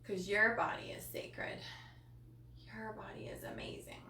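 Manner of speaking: soft whispered affirmation. Real, not acted. Host whispering comfort to her viewers, hushed. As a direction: breathe it to them gently.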